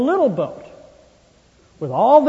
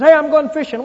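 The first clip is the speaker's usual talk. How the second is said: higher, louder call of a restless fisherman; 6 semitones higher, 6 dB louder than usual. Retold or imitated. Peter tells the other disciples he's going fishing, loud call. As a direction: announce it loud to the whole crew.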